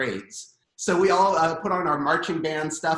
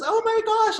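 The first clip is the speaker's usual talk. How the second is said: high squealed gush; 6 dB louder than usual. Retold or imitated. Bartender to the parents, a high delighted squeal. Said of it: "so she goes".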